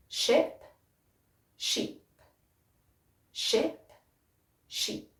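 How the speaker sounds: short I in ship, long smiling E in sheep, the sheeps very soft; ship sheep contrast. Shown as a demonstration. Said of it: "With sheep, it's almost like I'm smiling".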